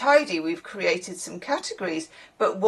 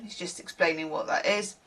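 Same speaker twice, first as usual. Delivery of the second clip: ordinary talk, nothing marked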